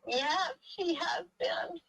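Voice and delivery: high-pitched, slow, earnest agreement; eager agreement. Retold or imitated. She answers the man, agreeing in a high humble voice. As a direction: squeak out a humble yes. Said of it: "And I said".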